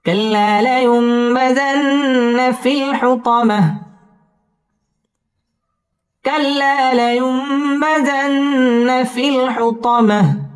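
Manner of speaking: slow, chanted tajweed recitation, high and drawn out; Quran recitation. Shown as a demonstration. Teacher reciting Surah Humazah for students, slow chanted tajweed. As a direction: intone each verse slowly with long held vowels.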